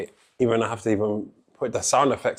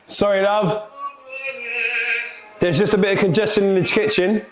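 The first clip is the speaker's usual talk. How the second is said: higher, polite waiter voice; smooth over the delay like a flustered waiter; apologetic waiter; 7 semitones higher than usual. Acted. Waiter apologising to the customer for the kitchen delay, high and polite.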